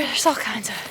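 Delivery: winded from carrying a heavy load; puffing between words; out of breath. Real, not acted. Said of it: "sorry, I'm out of breath".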